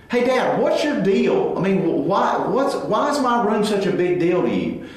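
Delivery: a mock snippy teenager, pushing back at her dad; teen attitude. Teen daughter sasses her father about the room, mock snippy. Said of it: "she cops an attitude".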